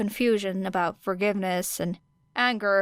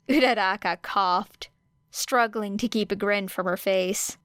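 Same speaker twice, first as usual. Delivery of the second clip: plain reading voice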